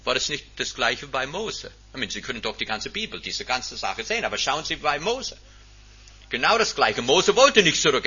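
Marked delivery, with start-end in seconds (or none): none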